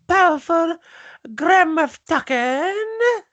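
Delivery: very high, drawn-out alien announcer; Bib Fortuna. Acted. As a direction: announce slowly in a high, Filipino-sounding creature voice. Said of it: "my Bib Fortuna suddenly became Filipino".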